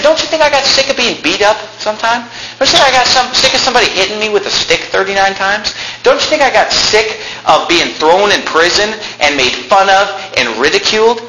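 high-pitched, indignant rhetorical questions; exasperated apostle. Acted. Preacher as Paul, sick of being beaten, exasperated.

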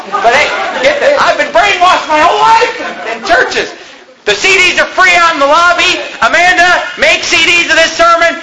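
high-pitched, impassioned pulpit shouting; full-force preaching. Real, not acted. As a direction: preach it at the top of your voice.

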